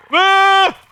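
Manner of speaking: sudden high scream of rage; frustrated scream. Real, not acted. Gamer screaming at the enemy, high furious yell. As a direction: let out a raw yell of frustration.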